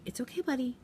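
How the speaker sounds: put-on teacher soothing a flustered boy; soft-spoken reassurance. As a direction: ease him down softly. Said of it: "be very soft-spoken".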